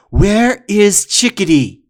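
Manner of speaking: slow, loud, high threatening demand; menacing slow shout; fierce demand. Acted. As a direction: loom over him and demand it. Warrior demands the boy.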